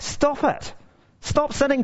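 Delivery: high, rising scold; preacher mock-scolding his listeners. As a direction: nag the congregation playfully.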